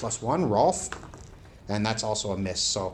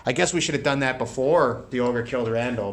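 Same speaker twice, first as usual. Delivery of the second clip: normal talking voice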